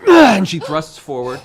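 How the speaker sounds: loud, slow, dramatic; dramatic boom. Acted. GM announcing the daughter's lunge, loud and slow. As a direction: boom it out as the big moment.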